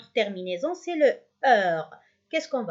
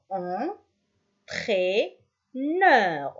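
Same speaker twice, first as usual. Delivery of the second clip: "Entraîneur" very slowly as en, traî, neur; very slow syllable count on "Entraîneur"; split into syllables; much slower than usual. Shown as a demonstration. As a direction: leave long pauses inside "Entraîneur". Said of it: "On a trois".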